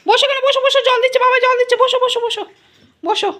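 high, loud singsong coaxing the dog; pet baby talk. Acted. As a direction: talk to him like a toddler, high and bright. (উঁচু গলায় আদুরে সুরে কুকুরকে ডাকা)

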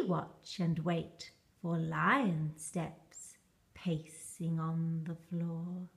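breathy storybook whisper; hushed suspense. A narrator's reading. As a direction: whisper it, waiting for lion steps. Suspense, waiting for lion.

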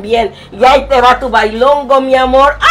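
usual speaking voice

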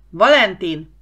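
loud, urgent call of the name; shout into microphone. A narrator's reading. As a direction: shout for a crewmate over the radio. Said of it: "Tírosz belekiáltott a mikrofonba".

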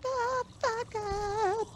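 high squeal. Acted. Friends banter, mock outburst.

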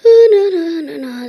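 loud alarmed burst then dropping. Real, not acted. Kid spots bad build in his world, alarmed. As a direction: cry out at the sight, then trail off. Sees something bad in game.

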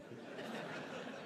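high unvoiced burst of laughter; real amused laugh; breathy laugh. Real, not acted. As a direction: laugh at the absurdity of it. Director called test crap.

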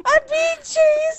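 high, drawn-out cry for help; panicked wail. Real, not acted. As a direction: wail it while fleeing. Being chased in game.

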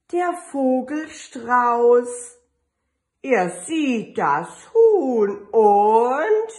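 put-on lively, drawn-out read-aloud for little kids; storybook reading. Teacher reading an Easter book to her kindergarten kids, lively and drawn out. Reads picture book to children.

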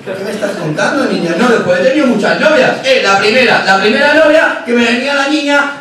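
normal talking voice

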